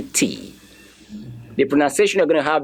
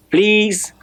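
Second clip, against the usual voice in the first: ordinary talk, nothing marked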